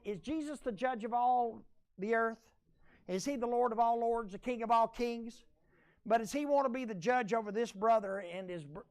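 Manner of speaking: usual speaking voice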